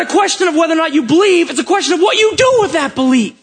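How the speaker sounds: high, driving preacher's delivery; forceful preaching. Real, not acted. Preaches with raised voice.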